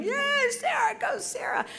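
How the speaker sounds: high, excited mock cheer. Control room cheering her through the earpiece, high and excited. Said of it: "control room back at H D H cheering".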